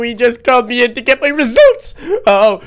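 ordinary talk, nothing marked

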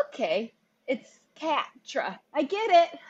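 mock comprehension. Mocking a too-obvious character name, exaggeratedly getting it. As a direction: pretend the obvious pun just landed.

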